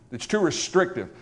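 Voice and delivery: usual speaking voice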